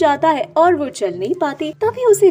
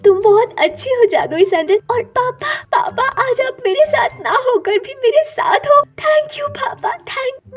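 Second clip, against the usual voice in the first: put-on very high, emotional girl's voice; high-pitched, moved and thankful daughter; overjoyed gratitude; 11 semitones higher than usual. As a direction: gush with thanks to a beloved father. Kirti overjoyed, thanking her father.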